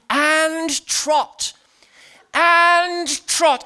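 high, drawn-out riding command; imitated shout. Friend on a pony calling to the runaway horse, high shout. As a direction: call it out to a horse far ahead. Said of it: "I heard my friend shouting".

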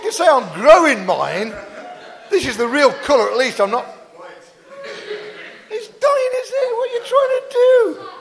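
high, hammed-up indignant shouting; mock outrage. Preacher teases a man about dyeing his hair, mock-outraged high shouting. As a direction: shout in comic outrage, pitch soaring. Comic quarrel over dyed hair.

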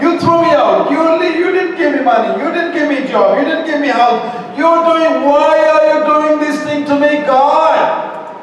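high, fast whiny list of grievances; mock whining complaint. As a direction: whine at God like a spoiled child.

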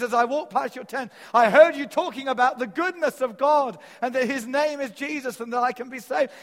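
high and loud, pleading; urgent plea. Retold or imitated. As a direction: call it out as if desperate. Soldier's plea continued.

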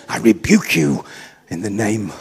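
hammed-up rebuke of the clerk; mock rebuke.

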